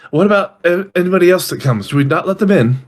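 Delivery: night-shift servant. Acted. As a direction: check orders politely and uncertainly.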